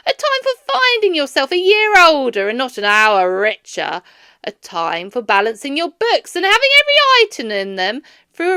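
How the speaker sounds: loud, high, bitter tirade; ranting Scrooge. A narrator's reading. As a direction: pile up the grievances furiously. Scrooge rants at nephew.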